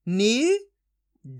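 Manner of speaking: "नी" held rising, pause, then "ड" alone; teacher's isolated sounds "नी", "ड"; letter-by-letter sounding out. Shown as a demonstration. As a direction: sound out "नी" and "ड" as separate syllables. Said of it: "एक एक अक्षर का उच्चारण करके".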